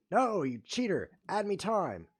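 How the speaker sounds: high, loud indignant protest; outraged yell. Real, not acted. Yelling at a cheating chess opponent, outraged shout.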